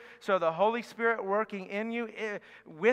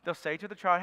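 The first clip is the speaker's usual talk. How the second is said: ordinary talk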